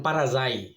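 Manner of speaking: "পারা যায়" said as the Portuguese word with a falling close; Portuguese pronunciation. Shown as a demonstration. Vlogger demonstrating the Portuguese word for bus stop, "পারা যায়" said carefully. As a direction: show how "পারা যায়" sounds in Portuguese. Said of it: "এম এর উচ্চারণ".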